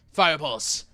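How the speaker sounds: loud battle cry; yell. Acted. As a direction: shout the warning. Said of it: "you hear them yell".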